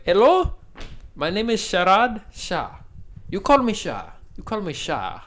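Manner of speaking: the Nissan salesman greets him in a put-on Indian accent; Indian accent impression. Car salesman introducing himself, hammed-up Indian accent. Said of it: "And he was like".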